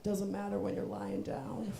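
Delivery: low, soft, slow reply; understated motherly comeback, hushed and low; sly mom. Retold or imitated. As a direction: imitate mom, dropping it quietly and slowly. Voices mom's sly reply.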